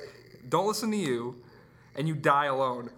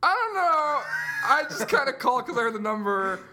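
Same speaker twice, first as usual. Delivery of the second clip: high, loud, panicky retelling of himself on the phone; flustered caller; 5 semitones higher, 4 dB louder than usual. Retold or imitated. Caller stumbling when the radio producer asks his topic, mock panicked. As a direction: blurt it out like a caught-off-guard caller. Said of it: "I said".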